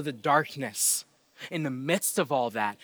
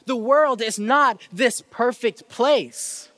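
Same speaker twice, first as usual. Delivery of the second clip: impassioned preaching; 6 semitones higher, 5 dB louder than usual. Acted. Preacher warning the congregation about a broken world, impassioned preaching. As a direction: press the point home, pitch lifted and insistent.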